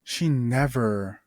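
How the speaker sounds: usual speaking voice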